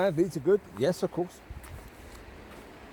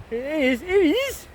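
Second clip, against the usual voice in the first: high, loud happy call to the dog; excited dog greeting; 8 semitones higher, 4 dB louder than usual. Real, not acted. Owner greets her dog, excited. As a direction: sing out to the dog, much higher.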